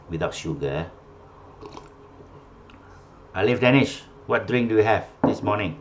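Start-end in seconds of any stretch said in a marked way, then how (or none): 3.4-4.1 louder, higher name call. Real, not acted. Calling on student to answer.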